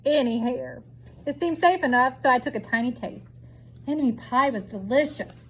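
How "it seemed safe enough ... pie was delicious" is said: usual speaking voice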